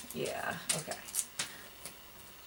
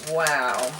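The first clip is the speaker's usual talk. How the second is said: ordinary talk, nothing marked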